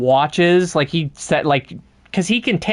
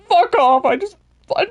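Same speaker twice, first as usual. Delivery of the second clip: high-pitched, faltering mock rebuff; mock exasperation; 11 semitones higher than usual. Ringmaster telling the joking lawyer to go away, mock exasperated. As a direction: put on a fed-up, higher voice.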